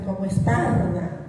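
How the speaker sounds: Esparda with a loud, strongly trilled r; ultra-correct R. Shown as a demonstration. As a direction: stress the r in Esparda hard and loud. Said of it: "que se llama una R de ultra correcta".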